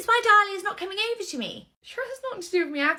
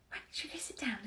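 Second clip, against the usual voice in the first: soft hushed whisper; nursery worker whispering to a colleague; 3 semitones lower, 13 dB quieter, faster than usual. Acted. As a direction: ask it under your breath. Discreet aside.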